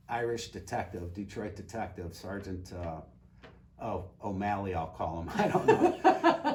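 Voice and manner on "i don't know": laughing. Real, not acted. Laughs over made-up detective name.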